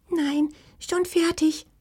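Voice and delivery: high, slow tiny creature voice; small high fox voice, yelping; gasping little voice. A narrator's reading. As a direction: squeak it out as if short of breath. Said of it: "japste die Stimme".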